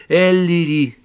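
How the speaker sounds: high, loud cry of a name; urgent call. Acted. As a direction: call out sharply to snap someone to attention. Pat shakes Ellery, calling him.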